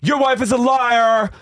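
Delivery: high-pitched accusing imitation; hammed-up indignant complaint; mock outrage. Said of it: "she storms off".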